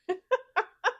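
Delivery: high happy giggle; delighted giggle. Real, not acted. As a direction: giggle with glee. Delighted after finishing.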